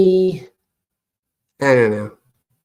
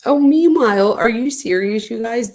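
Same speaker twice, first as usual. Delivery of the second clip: incredulous; 6 semitones higher than usual. Real, not acted. Reacting to gossiping couple.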